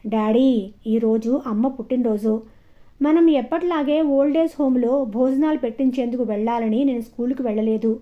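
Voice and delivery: plain reading voice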